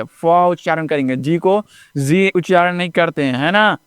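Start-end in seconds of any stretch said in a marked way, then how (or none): 0.0-0.2 फ as "fuh", not "ef"; letter sound फ, "fuh". Shown as a demonstration. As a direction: sound out फ with an open vowel after it. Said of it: "एफ को एफ नहीं करेंगे".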